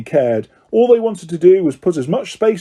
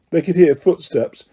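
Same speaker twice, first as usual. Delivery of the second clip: ordinary delivery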